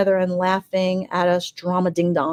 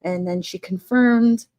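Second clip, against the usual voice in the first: normal talking voice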